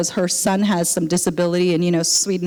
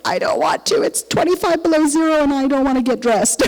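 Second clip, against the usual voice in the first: raised, put-upon whine; grumbling about the cold in a higher whine; whiny reluctance; 5 semitones higher than usual. Retold or imitated. Whining to the Spirit about going out.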